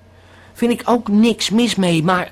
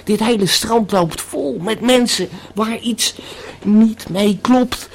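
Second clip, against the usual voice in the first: ordinary delivery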